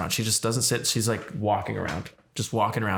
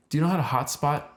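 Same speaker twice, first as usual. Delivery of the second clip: higher, imitated Russian question; thick Russian accent; 6 semitones higher than usual. Russian lady asking a stranger for tech help, thick Russian accent. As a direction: ask it in a put-on Russian accent. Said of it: "very thick Russian accent".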